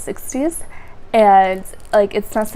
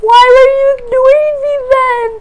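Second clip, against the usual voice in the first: high, loud crying protest; tearful accusation; 10 dB louder than usual. Retold or imitated. To the doctor who ignored her, loud tearful protest. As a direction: wail it through tears. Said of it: "I'm like".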